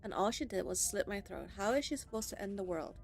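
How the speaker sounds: normal talking voice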